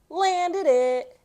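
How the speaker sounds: high, loud cry of triumph; triumphant cheer. Acted. Reader celebrates a landed skateboard trick, high and loud. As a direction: shout it like you just nailed the trick. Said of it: "That's how I would say landed".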